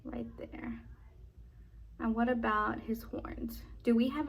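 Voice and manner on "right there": soft whispered aside; whispering. Acted. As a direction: breathe it, no voice. Whispered aside during picture card.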